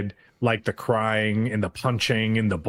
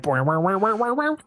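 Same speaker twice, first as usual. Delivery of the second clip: rising boing boing sound effect; silly spoken transformation noise; mock sound effect; 7 semitones higher than usual. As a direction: make the cartoon boing noise yourself. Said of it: "he had to make the sound effects too".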